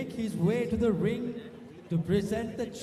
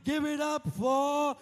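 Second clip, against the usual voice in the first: announcer hype; 3 semitones higher than usual. Real, not acted. Hyping crowd for runner-up.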